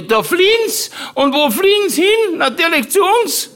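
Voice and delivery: very high, loud aunt voice; indignant aunt ranting in a squeaky-high register; Tante Mari. Acted. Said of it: "weil die Tante Mari gesagt hatte".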